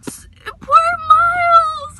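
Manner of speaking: high, loud, slow wail of pity; anguished cry. Real, not acted. Reviewer wailing over a character's fate, anguished. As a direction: cry out his name as if heartbroken. Crying out over a sad book.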